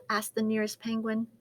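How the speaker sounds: plain reading voice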